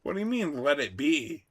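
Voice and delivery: high, disbelieving question; incredulous. Real, not acted. Incredulous at letting it be.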